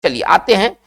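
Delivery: ordinary talk, nothing marked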